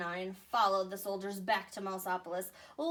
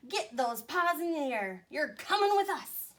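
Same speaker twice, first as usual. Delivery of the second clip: bossy mouse commander; 5 semitones higher, 4 dB louder than usual. Acted. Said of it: "squeaked the Big Cheese".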